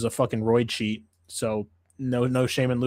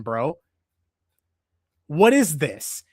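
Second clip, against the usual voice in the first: normal talking voice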